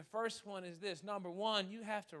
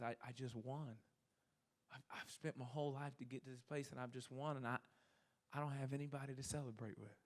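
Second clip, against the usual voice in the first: low, hushed, understated heartbreak; retold tearful confession; 5 semitones lower, 9 dB quieter than usual. Lonely winner confides in a stranger, soft and tearful. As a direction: quietly imitate a man overcome. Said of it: "he started to cry".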